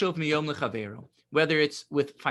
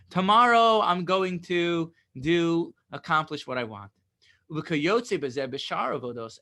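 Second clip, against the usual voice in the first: ordinary delivery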